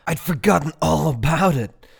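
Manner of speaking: loud, high, excited outburst; bubbling over with enthusiasm; enthusiastic. A narrator's reading. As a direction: gush it out with delight. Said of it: "with as much enthusiasm as Roz had ever seen".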